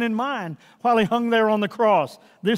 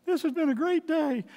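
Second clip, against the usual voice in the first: overjoyed daughter; 9 semitones higher than usual. Retold or imitated. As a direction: lift up into your daughter's tearful joy. Daughter's words voiced in story.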